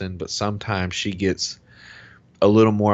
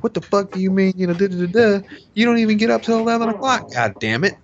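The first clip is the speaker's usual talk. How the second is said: reenacted rant, pitched way up; angry retort; 14 semitones higher, 4 dB louder than usual. Husband reenacting his retort to his late-rising wife, incensed. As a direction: argue back hotly in a high, strained rush of outrage.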